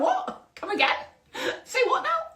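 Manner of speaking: very high, laughing come again; incredulous disbelief. Retold or imitated. Host retelling the landlord's rejection, very high laughing disbelief. Retold shock at email news.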